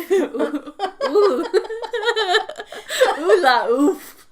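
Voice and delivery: slow laughing ooh la oof; drawn-out giggly ooh la oof. Pretend.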